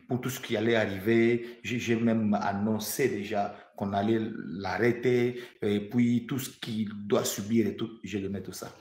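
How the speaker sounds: ordinary talk, nothing marked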